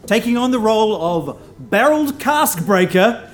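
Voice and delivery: building to a loud introduction; announcer shout. Real, not acted. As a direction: call the name to the back row. Host introduces player to crowd.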